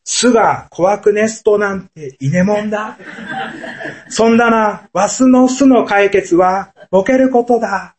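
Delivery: Akita dialect. Retold or imitated. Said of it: "秋田弁なまりでこう答えたそうです". (秋田弁なまりで笑いながら軽く演じる)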